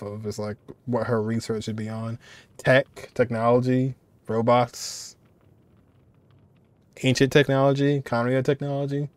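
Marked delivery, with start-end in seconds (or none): none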